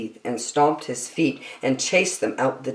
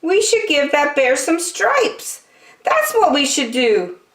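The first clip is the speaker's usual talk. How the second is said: higher, bright-eyed zebra; 13 semitones higher than usual. Acted. Zebra stops the friends, in a high voice. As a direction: pipe up with an idea, pitched high. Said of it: "said Zebra".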